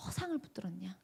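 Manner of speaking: soft unvoiced breath of a solemn word; hushed whisper. The director speaking God's verdict to the student, in a whisper. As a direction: whisper it, a grave secret rebuke.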